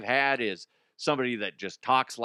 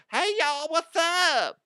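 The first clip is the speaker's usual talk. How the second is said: high, exaggerated Southern accent; strong accent; 4 dB louder, slower than usual. Applicant with a strong accent greeting viewers, high Southern twang. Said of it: "somebody with a strong accent".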